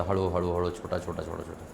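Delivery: fading to a near hush; quiet, drizzling softly, fading away; soft gentle rain. Shown as a demonstration. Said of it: "रात्रभर पाऊस चालू होता". (हळुवार, कुजबुजत जाणारा आवाज)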